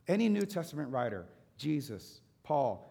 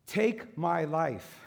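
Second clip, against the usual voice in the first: slow plea; 3 semitones higher, 6 dB louder, much slower than usual. Acted. Elijah's plea voiced.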